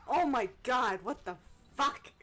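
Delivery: loud, exasperated cry; shouted, high-jumping exasperation; exasperated outburst. Real, not acted. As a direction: burst out in disbelief at yourself. Exasperated at own failed impressions.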